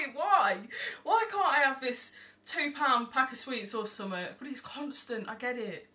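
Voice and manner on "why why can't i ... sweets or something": put-on louder, higher childish whine; whining child protest. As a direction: beg like a kid denied sweets.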